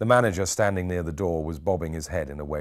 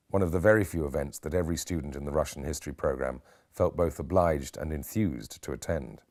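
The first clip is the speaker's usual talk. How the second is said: ordinary delivery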